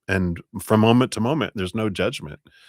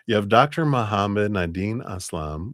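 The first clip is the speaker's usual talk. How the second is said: normal talking voice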